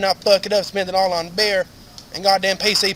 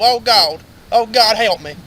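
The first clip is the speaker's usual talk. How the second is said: loud, higher mock pleading; imitated plea for help; 4 semitones higher, 9 dB louder, slower than usual. Lawman repeats what the man said in the woods, mock pleading. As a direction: cry out as if imitating a delirious man.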